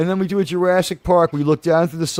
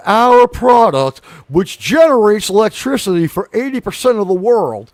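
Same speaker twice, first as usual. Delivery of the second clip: Billy Bob Thornton; 3 semitones lower than usual. Acted. Landman lecturing her on green energy, slow and emphatic. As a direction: start low and deliberate, then punch it out. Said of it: "do your Billy Bob Thornton impression".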